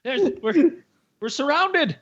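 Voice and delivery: high-pitched hammed-up alarm; mock panic. English crowd seeing stars first time, mock panic. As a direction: cry out in pretend terror. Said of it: "everyone just fucking lost it".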